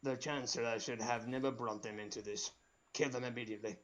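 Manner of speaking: cold command from the shadows; Darth Sidious. Acted. Sidious voice held.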